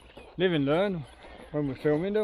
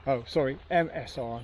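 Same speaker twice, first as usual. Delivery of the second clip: ordinary talk, nothing marked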